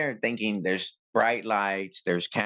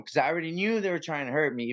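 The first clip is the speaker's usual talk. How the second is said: ordinary talk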